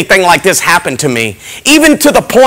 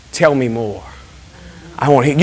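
low, soft and calm; quietly curious judge; gentle; 6 semitones lower, 8 dB quieter than usual. Retold or imitated. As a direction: invite him to go on kindly.

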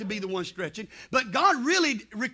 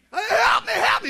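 high, loud drowning yell; acted panicked cry; 10 semitones higher, 5 dB louder than usual. Boy thrown in river, acted panicked cry for help. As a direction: cry out for rescue, hammed up.

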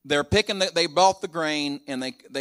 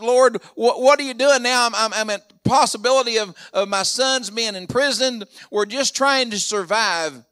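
louder, higher anxious plea; Jacob's desperate prayer; 8 semitones higher, 7 dB louder than usual. Retold or imitated. Jacob fearing for his imprisoned sons, loud desperate plea. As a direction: pray it out like a frightened old father. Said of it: "Jacob probably thought".

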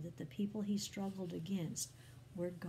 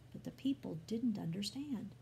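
plain reading voice

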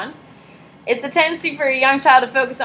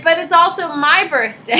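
higher, laughing mock argument; laughing protest; 6 semitones higher, 4 dB louder than usual. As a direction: argue back through a laugh. Adult arguing, laughing.

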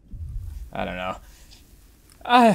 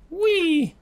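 high, drawn-out little squeal; playful whee. Real, not acted. Player amusing himself while steering game, playful squeal. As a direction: let out a high, gleeful "wee".

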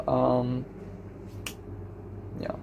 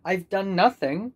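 ordinary talk, nothing marked

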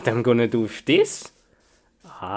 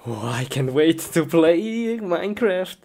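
eager anticipation; 2 semitones higher than usual. Acted. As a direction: let the eagerness climb as you go. Eager to play Minecraft.

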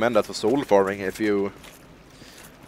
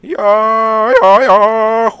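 loud, high, drawn-out cheer; real excited shouting; 9 semitones higher, 8 dB louder, much slower than usual. Real, not acted. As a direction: whoop it in triumph. Cheering a hit.

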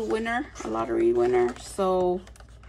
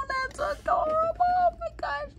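much higher, squeaky delight; squeaky gush. Real, not acted. Fan gushing to viewers over box art, high squeak. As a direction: squeak it out, overjoyed.

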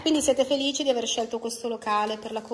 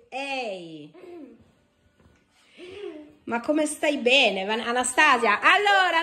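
normal talking voice

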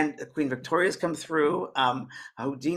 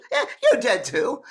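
high, louder imitated woman; dead sister; 10 semitones higher, 6 dB louder than usual. Sister breaking the news to the lady, high put-on voice. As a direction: play the sister's cheerful retort, pitched up. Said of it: "my sister, who's been dead".

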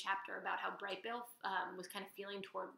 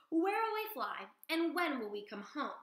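put-on higher, eager child voice; asking the flock; young gosling; 7 semitones higher, 5 dB louder than usual. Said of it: "said Bright Bill".

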